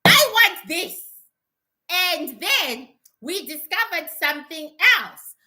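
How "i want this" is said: loud, higher-pitched demand; forceful raised-voice outburst; demonstrated scream. Shown as a demonstration. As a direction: show how to demand it angrily. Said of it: "You have to scream at them".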